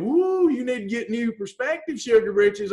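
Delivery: usual speaking voice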